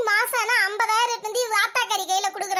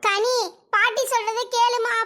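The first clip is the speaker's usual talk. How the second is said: usual speaking voice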